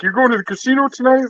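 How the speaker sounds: high, mock curious question; teasing asker. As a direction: tease the native guy about the casino. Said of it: "They're like".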